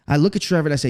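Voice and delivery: usual speaking voice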